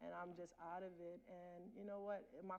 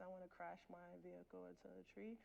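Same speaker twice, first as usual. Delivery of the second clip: quiet; 9 dB quieter than usual. Retold or imitated. Recalling dark thoughts quietly.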